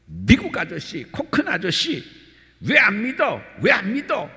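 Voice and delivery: loud, scolding street evangelist; scolding shout. Retold or imitated. Evangelist loudly scolding the American monk. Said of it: "나무라듯 큰 소리로".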